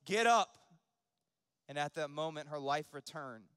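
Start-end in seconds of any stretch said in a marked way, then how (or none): 0.0-0.6 commanding call that jumps high and loud; raised preacher's voice, building to a shout. A narrator's reading. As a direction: call it out with authority, punching the end. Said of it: "said in a loud voice".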